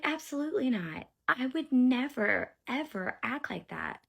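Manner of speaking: high, loud, emphatic protest; indignant denial. Retold or imitated. Mother denies the accusations to DCF worker, indignant.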